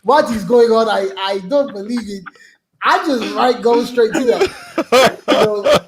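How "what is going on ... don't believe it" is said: put-on African accent, protesting innocence. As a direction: play the bewildered foreigner in an African accent. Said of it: "I revert to my African accent immediately".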